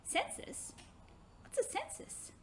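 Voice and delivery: put-on breathless, puzzled little girl asking a word back; a child baffled by a strange word. Hannah puzzled by unknown word.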